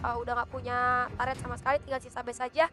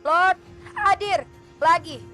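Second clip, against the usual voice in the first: loud, drawn-out arena call; hype announcement; 3 semitones higher, 7 dB louder, much slower than usual. Real, not acted. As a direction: stretch it out like an announcer. Announcing the Lord spawn.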